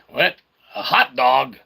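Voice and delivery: ordinary talk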